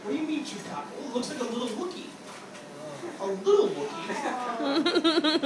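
usual speaking voice

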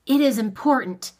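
forceful, insistent command; stern authority. A narrator's reading. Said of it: "put into his voice the stern".

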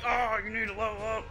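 high-pitched imitation of a nagging viewer; mock nagging viewer. Player imitating viewers telling him to level up, mock nagging. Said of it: "Some of you are probably like".

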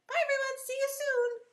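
very high little puppet voice. Pretend. Puppet waving off the class, high puppet voice. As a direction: squeak out a sing-song goodbye. Puppet Betsy says goodbye.

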